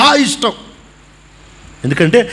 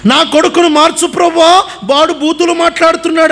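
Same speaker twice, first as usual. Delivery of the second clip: pleading prayer; 8 semitones higher than usual. Retold or imitated. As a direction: cry out to the Lord for your son. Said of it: "అంటాడు".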